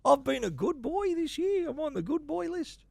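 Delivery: put-on high good-boy kid voice; childish voice. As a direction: plead like a well-behaved kid. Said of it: "Why are we talking like that".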